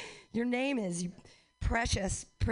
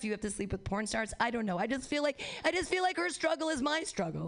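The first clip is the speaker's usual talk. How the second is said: ordinary talk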